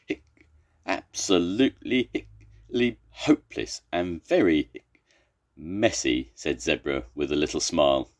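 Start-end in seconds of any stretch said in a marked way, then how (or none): 0.1-6.3 words chopped apart by repeated hiccups; hiccuping. Acted. Zebra after choking on the water, hiccuping. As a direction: hiccup between and inside the words.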